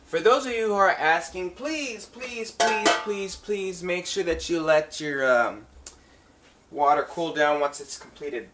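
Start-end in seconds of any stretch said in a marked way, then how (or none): none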